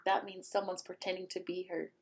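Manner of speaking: quick soft explanation to listeners; hushed voice. Acted. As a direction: say it under your breath. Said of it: "whispered the kids".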